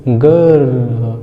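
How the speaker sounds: गर्ल said slowly, vowel drawn out, no r; stretched vowel. Shown as a demonstration. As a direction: draw out गर्ल. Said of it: "आर जो है ... नहीं आएगा".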